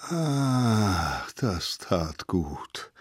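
slow, soft, long exhale of relief; relieved sigh. A narrator's reading. Mammoth gets cool moss on bites, relieved. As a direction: sink into it with a drawn-out sigh.

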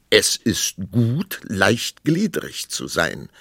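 slow, measured pronouncement; arguing king. A narrator's reading. King arguing wordplay with queen, slow and measured. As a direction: state it ponderously, stretching each word. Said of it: "sagte der König".

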